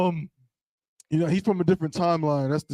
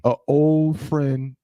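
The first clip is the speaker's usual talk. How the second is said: slow dramatic build-up; much slower than usual. Acted. Telling a friend the gossip, slow and teasing. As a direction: stretch out "an old friend" slowly for suspense.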